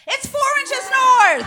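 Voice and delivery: high, loud, slow shout; exasperated yell. Acted. Comic scolding lazy guys, slow loud shout. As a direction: bellow the directions at them.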